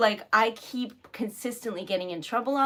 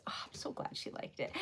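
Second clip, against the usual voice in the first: very soft, all whisper; delighted whispered aside; 17 dB quieter, faster than usual. Real, not acted. Streamer to herself about the customer's review, whispering happily. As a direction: drop to a private, happy whisper.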